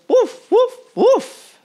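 high, loud woofs; happy barking. A narrator's reading. Titus barking proudly at the end.

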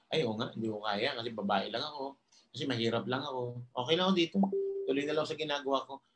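defeated self-talk. Retold or imitated. Speaker acting out a listener who believes the monkey, quietly resigned. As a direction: mumble it like someone who has given up. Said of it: "Di ba naniwala ka?".

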